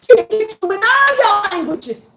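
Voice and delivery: high, loud, fired-up proclamation; worked-up boasting. Real, not acted. Boasting of what the family can do together, worked-up.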